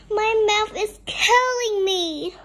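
screamed complaint. A narrator's reading. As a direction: wail it out like a hammed-up sufferer. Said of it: "he screamed".

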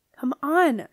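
a loud, impatient hurry-up. A narrator's reading. Lucy calling down the chute to Wolf Boy, impatient and loud. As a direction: urge him on, out of patience. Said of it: "she said impatiently".

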